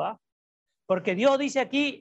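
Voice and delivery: ordinary talk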